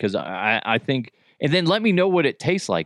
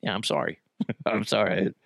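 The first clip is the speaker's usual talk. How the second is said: ordinary talk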